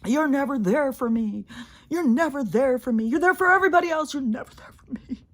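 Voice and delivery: high, loud, whiny mock voice of a needy partner; mocking whiny complaint. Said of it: "these people always are like".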